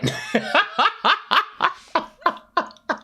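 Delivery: long, high-pitched laugh; real laughing fit. Real, not acted. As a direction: keep laughing in a high cackle. Amused by own therapy story.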